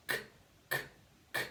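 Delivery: short crisp k, /k/ with no added vowel; phonics k sound. Shown as a demonstration. Teacher modelling the k sound for pupils to repeat. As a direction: model the pure k sound for children to copy. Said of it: "say the sound with me first".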